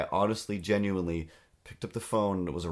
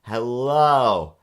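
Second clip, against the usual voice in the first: hellos getting louder, into a near shout; growing louder hellos; 2 semitones higher, 8 dB louder, slower than usual. Retold or imitated. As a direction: call into the phone, louder each time. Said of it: "I say".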